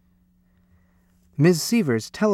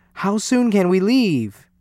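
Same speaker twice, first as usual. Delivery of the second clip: raised, excited question; eager cry; 6 semitones higher, 3 dB louder than usual. A narrator's reading. Parents delighted with cruise tickets, eager cry. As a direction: ask it eagerly, pitched up. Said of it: "they cried".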